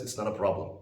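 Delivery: normal talking voice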